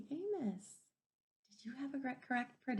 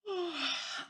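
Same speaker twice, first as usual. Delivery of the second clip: sleepy yawn; 7 semitones higher than usual. Acted. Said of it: "Amos yawned".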